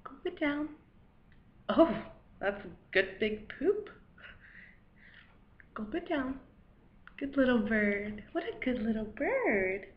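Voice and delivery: soft coaxing. Acted. Feeding a baby bird, soft coaxing. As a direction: gently coax a baby creature, quietly.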